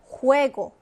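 juego drawn out slowly, a clear hard g-sound start; Spanish juego. Shown as a demonstration. Teacher modelling juego for students, very slow and clear. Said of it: "삼 번 보겠습니다".

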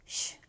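"쉬" as a hushing "sh"; isolated "sh" sound. Shown as a demonstration. Teacher contrasts two symbols for students, "쉬" as isolated sh. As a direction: sound out "쉬" as the lone consonant. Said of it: "우리가 쉬 발음한다 했죠".

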